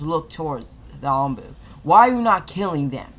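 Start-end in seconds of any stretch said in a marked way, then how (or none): 1.8-3.1 higher, louder demanding shout; angry demand. A narrator's reading. Jonin shouting at the Anbu, loud and demanding. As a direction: bark the question at the traitor.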